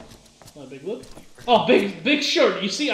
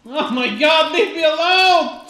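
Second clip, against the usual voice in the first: panicked yelling; 6 semitones higher, 7 dB louder, slower than usual. Real, not acted. Panicked at a spider.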